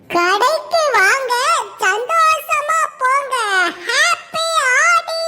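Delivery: slow, drawn-out sales cry; singsong street hawker. Acted. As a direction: stretch it like a shop caller. Hawker calling customers.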